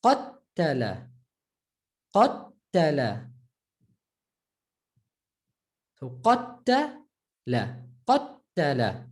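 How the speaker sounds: Qattala, slow, the doubled t held; stressed doubled ta. Shown as a demonstration. Teacher drilling the doubled t slowly for students. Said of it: "The ta".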